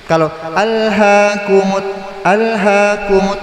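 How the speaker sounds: "alhaakumut" with the "h" from the throat. Shown as a demonstration. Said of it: "dari tenggorokan". (h pada "alhaakumut" dari tenggorokan)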